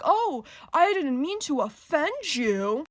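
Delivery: mocking fake apology. Mocking the arrogant guy's apology.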